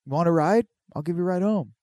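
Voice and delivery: lightly acted friendly neighbor; friendly offer. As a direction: offer a favor like a keen buddy.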